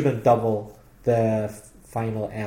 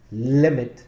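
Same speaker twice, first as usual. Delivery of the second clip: limit with a firm stress on li; stress on first syllable. Shown as a demonstration. Teacher explaining the single T, stress on li in limit. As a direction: stress the opening syllable of limit, then let it fall. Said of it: "the stress is on li".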